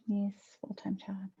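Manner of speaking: very soft, whispered murmur; whispering to self. Real, not acted. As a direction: whisper it under your breath. Murmurs to herself while concentrating.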